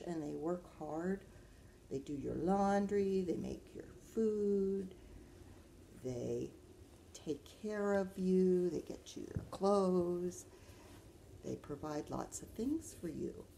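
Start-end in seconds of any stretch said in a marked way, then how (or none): none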